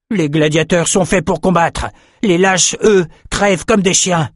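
high, forceful contempt; hardened champion. A narrator's reading. Champion rejects his old friend's mercy, contemptuous force.

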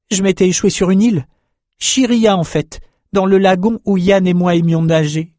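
high, bright rush of joy; excited. Acted. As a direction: tell it thrilled and breathless. Young man's joyful discovery.

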